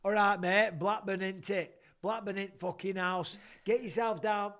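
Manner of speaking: put-on loud, high Blackburn accent. Host greeting Blackburn listeners, in a loud Blackburn accent. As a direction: belt it out in broad Blackburn.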